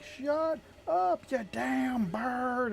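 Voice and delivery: put-on loud, high southern-accented holler. Guild mate yelling at his bird, mock southern accent. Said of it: "in, like, a southern accent".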